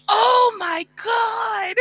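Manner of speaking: retold excited shout.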